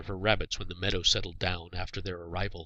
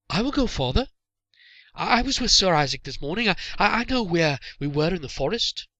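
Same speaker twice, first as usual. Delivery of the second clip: put-on much higher young voice, stammering on I, I; a nervous lad too keen to volunteer; young Malin pleading with his father to let him go; eager boy; 9 semitones higher, 6 dB louder than usual.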